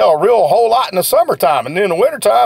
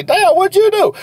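much higher, astonished mock exclaim; amazed customer; 12 semitones higher than usual. Customer blown away by the seller, astonished and much higher. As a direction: play the impressed customer reacting. Impressed customer in retold scene.

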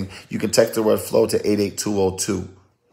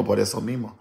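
ordinary talk, nothing marked

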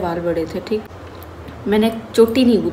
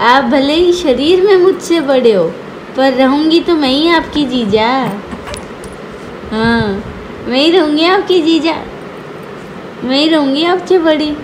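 put-on teasing childlike voice; 6 semitones higher, 5 dB louder than usual. Feeding child lines to cousin.

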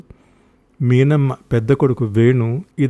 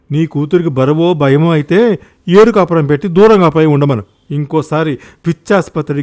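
louder, heated rebuke; angry threat; 3 semitones higher, 6 dB louder than usual. Acted. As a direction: warn them off, voice raised. Acted quarrel, threat to hit. (కోపంగా బెదిరిస్తూ)

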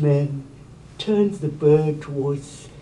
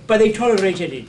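ordinary talk, nothing marked

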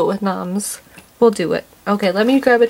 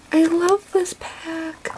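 higher, softer and slow; soft adoring; 6 semitones higher, 5 dB quieter, much slower than usual. Real, not acted. Vlogger adoring the whole pack, soft and slow. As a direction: sigh it out lovingly. After a gasp.

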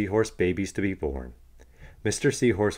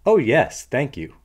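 eager yes; 6 semitones higher, 8 dB louder than usual. Acted. Missus Seahorse eagerly accepts help.

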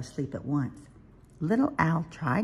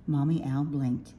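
usual speaking voice

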